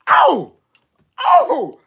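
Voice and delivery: high, loud mock cry; acted high-pitched outcry; shocked oh. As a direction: yelp two separate ohs. Acted cries at being laughed at.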